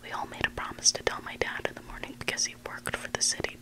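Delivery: plain reading voice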